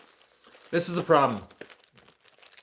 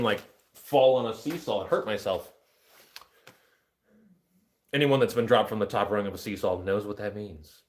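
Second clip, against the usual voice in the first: ordinary talk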